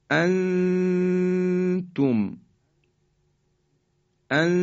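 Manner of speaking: انتم with the n held as a nasal hum for two beats; high, very slow hum on the start of انتم, falling onto تم; ikhfa with stretching. Shown as a demonstration. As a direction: stretch and hide the n of انتم before the t. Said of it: "همراه با مکس و کشش هم هست".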